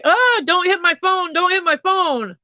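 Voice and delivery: high frantic cry, slowing at the end; desperate plea. Retold or imitated. Imitating the girl pleading with traffic, high and frantic. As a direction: beg the cars to miss the phone. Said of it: "And she's like".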